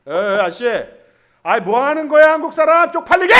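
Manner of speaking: put-on loud, rising scold at a drunk; scolding shout. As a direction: shame him into getting up. Yelling at drunk man to leave.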